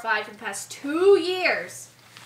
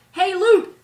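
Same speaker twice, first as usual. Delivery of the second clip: higher, much louder shout for attention; mock urgent call; 6 semitones higher, 11 dB louder than usual. Fan acting out how Rey does not approach Luke, mock urgent call. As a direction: call out boldly to get someone's attention. Acts out what she didn't do.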